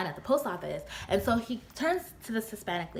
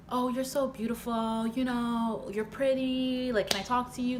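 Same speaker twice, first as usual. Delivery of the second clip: mock smooth-talking guy, higher pitched, drawn out; smooth talker; 5 semitones higher than usual. Man flattering a woman in line, mock smooth talk. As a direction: lay on the flattery, slow and higher. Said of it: "all that smooth talking crap".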